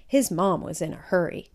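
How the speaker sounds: plain reading voice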